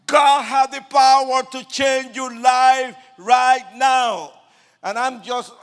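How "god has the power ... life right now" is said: loud, high preaching call over the crowd; street preacher screaming. Retold or imitated. Street preacher shouting God's message to the crowd. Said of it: "so loud screaming".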